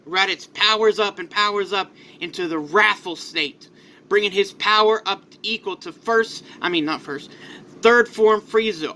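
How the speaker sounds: ordinary delivery, nothing marked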